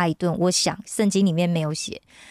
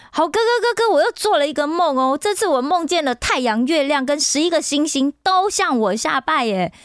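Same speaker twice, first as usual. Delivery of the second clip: a lightly voiced excited kid brother, pitched higher; eager bragging boy; 6 semitones higher than usual. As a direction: bounce in calling out to big brothers to show off. Joseph boasts dream to brothers.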